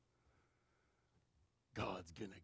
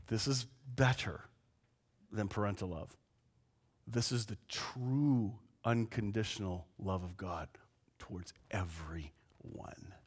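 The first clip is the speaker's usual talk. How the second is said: whisper; 4 semitones lower, 9 dB quieter than usual. Real, not acted. Pastor stresses God's unconditional love.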